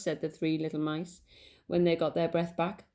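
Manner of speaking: usual speaking voice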